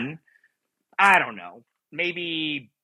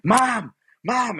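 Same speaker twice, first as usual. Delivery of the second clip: raised, high cry for Mom; retold scream; 8 semitones higher, 4 dB louder, much slower than usual. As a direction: call out for your mother, imitating a scream. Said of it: "I just started screaming her name".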